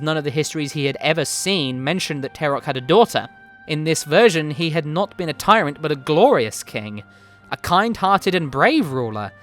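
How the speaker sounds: plain reading voice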